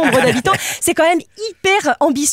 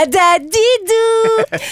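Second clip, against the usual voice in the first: singsong show name; 8 semitones higher, much slower than usual. Acted.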